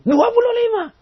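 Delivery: stubborn man refusing the correct answer; stubborn insistence. Retold or imitated. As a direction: insist like a mulish child.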